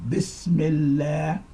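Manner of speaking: Bismillah with a hissed s, long final syllable; slow model Bismillah. Shown as a demonstration. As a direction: stretch Bismillah out slowly for learners. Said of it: "not biz".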